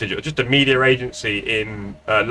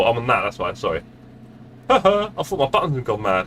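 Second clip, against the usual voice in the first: ordinary talk, nothing marked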